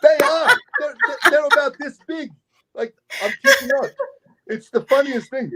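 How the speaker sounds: high, squeaky talk through giggles; real laughter. Real, not acted. Storyteller agreeing the cars are tiny, helpless amusement. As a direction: struggle to speak while cracking up. Both cracking up over the cars.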